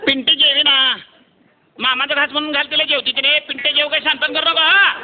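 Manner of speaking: high, loud, calling ताई down the line; loud Solapuri boy on the phone. Retold or imitated. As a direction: shout into the phone like a village lad. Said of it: "कसं बोलत होता बघा तुम्ही".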